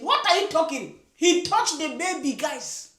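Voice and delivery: ordinary talk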